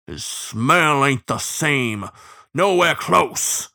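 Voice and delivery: slow howling insistence; furious sepulchral howl. A narrator's reading. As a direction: draw the words out like a baying bloodhound. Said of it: "furious howl creeping into his sepulchral voice".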